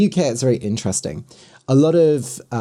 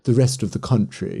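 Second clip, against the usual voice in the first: ordinary talk, nothing marked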